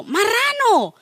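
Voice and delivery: high, drawn-out mock yell; imitated insult yell. Motorcyclist to the angry driver, mock yell.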